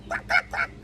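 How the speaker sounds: very high short clucks; imitated squawky chicken noises; chicken clucking. A narrator's reading. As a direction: cluck three times like a hen. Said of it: "said Chicken".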